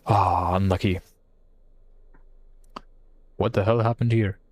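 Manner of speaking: ordinary delivery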